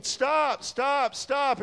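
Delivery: high, urgent cries, each falling; retold screaming. Preacher retells man halting cars on bridge, high imitated screaming. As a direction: imitate a man repeatedly crying out, pitched high. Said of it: "He just kept screaming".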